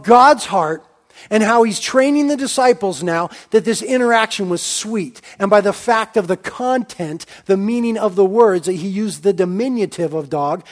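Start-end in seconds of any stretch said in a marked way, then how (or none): none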